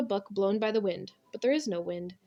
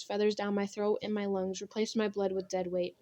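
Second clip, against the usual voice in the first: usual speaking voice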